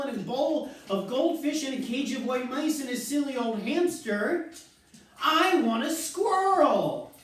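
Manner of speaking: put-on high, whiny, demanding bratty girl voice; spoiled child shouting. A narrator's reading. Veruca demanding a squirrel from her parents, high bratty whine. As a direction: stamp your foot like a spoiled brat. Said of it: "shouted Veruca".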